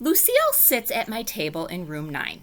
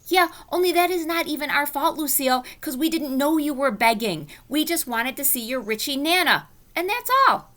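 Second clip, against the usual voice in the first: protesting; 9 semitones higher, 3 dB louder than usual. Acted. Junie B protesting to Lucille.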